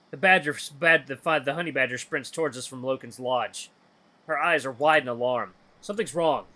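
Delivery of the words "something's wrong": ordinary delivery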